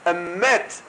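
high, emphatic imitation of a one-word exclamation; John Mevorach. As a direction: call it out high, mimicking someone. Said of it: "like, John Mevorach".

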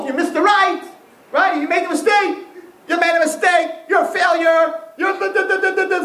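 high-pitched, hammed-up telling-off; mock scolding. Acting out school scolding a mistake.

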